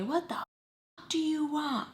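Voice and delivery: high, slow mock demand without volume; mom's angry confrontation. Mom confronting her after grabbing the phone, mock angry. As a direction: imitate mom demanding it right in your face. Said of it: "Okay, in my face".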